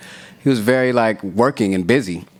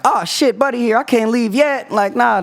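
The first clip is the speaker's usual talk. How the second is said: hammed-up higher voice of the producer; 14 semitones higher than usual. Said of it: "Quick's like".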